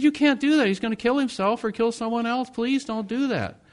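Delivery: high, urgent pleading; urgent plea. Retold or imitated. Pastor begging the counselor, high urgent plea. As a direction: beg them not to release him.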